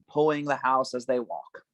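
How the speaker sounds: normal talking voice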